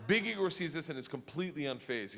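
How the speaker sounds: usual speaking voice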